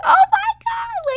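very high, loud, drawn-out squeal; freaking out. Retold or imitated. Girlfriend realizing he is about to propose, freaking out. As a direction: re-enact a freak-out in a piercingly high, stretched voice. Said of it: "I was freaking out".

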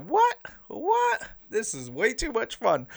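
high squeaky mock disbelief, then a delighted gush; excited disbelief. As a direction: squeal it like you can't believe the fun. Thrilled after first game.

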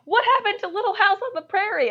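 high-pitched, quick mock dismay; hammed-up bewildered outcry, pitched high; retold upset. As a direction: imitate a distraught dad demanding answers. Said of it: "He's, like, really upset".

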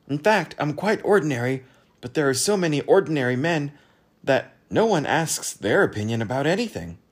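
high, animated self-justifying patter; confiding ordinary man. Acted. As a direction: explain the trick as a shared secret.

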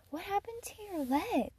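put-on high, childlike question; curious little girl. Girl spots a birthmark, high childlike question. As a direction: ask like a nosy kid.

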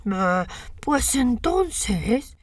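lobo astuto, muy lento; voz de lobo. A narrator's reading. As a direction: alarga cada palabra, lobo taimado. (voz de lobo lenta)

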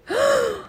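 sharp high gasp; shocked gasp. Acted. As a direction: gasp in horror like a child. Sister shocked by threat.

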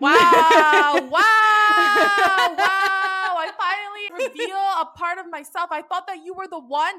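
loud, high, hammed-up wailing disappointment; mock-wounded outburst. Podcaster voicing spurned killer to girlfriend, hammed-up hurt. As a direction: wail each wow like a betrayed lover, then keep it high. Acts out the rejected killer boyfriend.

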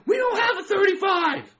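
high-pitched mock shout of outrage; yelling at the official. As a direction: holler it as if about to burst a blood vessel. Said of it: "trying to yell at the official".

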